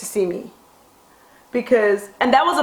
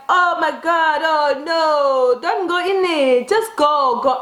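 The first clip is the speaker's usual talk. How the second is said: neighbor voice; 5 semitones higher, slower than usual. Retold or imitated.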